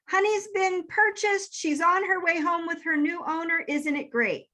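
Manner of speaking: high-pitched, excited good-news voice; bubbly mimicked announcement, pitched well up; cheery news. Lottie texts the puppy's new-owner news, cheerily high.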